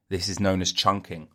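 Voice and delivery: ordinary delivery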